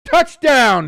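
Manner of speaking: high, loud touchdown yell; real burst of game-day excitement; excited shout. Real, not acted. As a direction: shout it like the play just happened.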